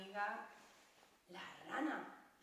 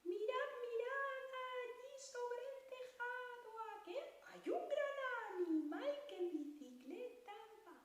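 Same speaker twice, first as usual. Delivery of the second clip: much higher, squeaky lamb singing; very high sheep voice; 11 semitones higher than usual. Pretend. The little sheep sings with a very high voice. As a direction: sing it way up like a tiny lamb. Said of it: "se le quedó la voz muy aguda".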